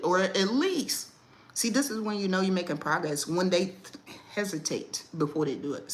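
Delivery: ordinary delivery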